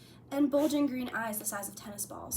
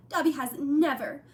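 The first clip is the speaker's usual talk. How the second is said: higher, louder elf voice; house elf voice; 4 semitones higher, 7 dB louder than usual. A narrator's reading. Dobby voiced throughout.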